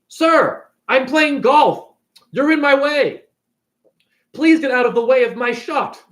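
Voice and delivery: pompous golfer demanding room, hammed-up; mock indignant golfer. Acted indignant golfers confronting a man.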